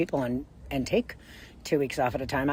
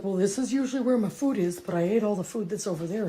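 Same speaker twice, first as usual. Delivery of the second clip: voicing the cat; 5 semitones higher than usual. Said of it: "He's like".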